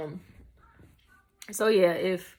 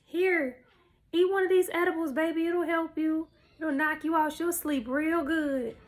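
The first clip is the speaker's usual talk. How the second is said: higher-pitched mom voice, offering help; lightly sing-song higher mother voice; retold mama; 6 semitones higher than usual. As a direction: imitate mama coaxing, up in pitch. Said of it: "She like".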